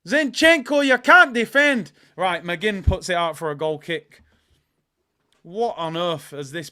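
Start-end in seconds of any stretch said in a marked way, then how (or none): 0.1-1.9 high, loud fan outburst; shouted criticism. Real, not acted. Fan berating Zinchenko's defending, high and shouted. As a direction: yell at the player in exasperation. Angry at player caught out.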